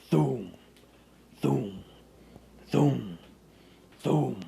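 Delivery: booming thum footsteps; loud thudding thum; loud thum sound. A narrator's reading. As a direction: pound each thum like a giant step. Heartbeat-like footsteps sound effect.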